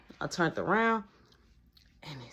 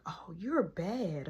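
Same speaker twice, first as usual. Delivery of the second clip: whispered tease; 9 dB quieter, much slower than usual. Retold or imitated.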